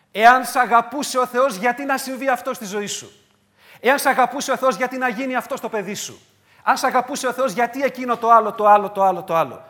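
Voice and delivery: loud, high taunting questions; the enemy needling a suffering believer; voice of the tempter. Acted. The tempter whispering doubt to the believer, a loud mocking taunt.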